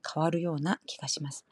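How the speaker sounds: ordinary talk, nothing marked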